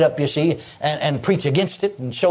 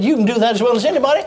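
high, quick preaching delivery; emphatic inner voice; 6 semitones higher, faster than usual. Retold or imitated. Preacher repeating what the Holy Ghost said, high emphatic proclamation. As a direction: proclaim it quickly, pitched well up. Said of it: "In the inside of me".

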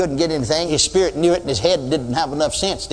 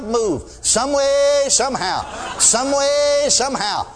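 imitating hollered prayer; 8 semitones higher, much slower than usual. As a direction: holler it like a desperate pastor. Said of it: "And he'd holler".

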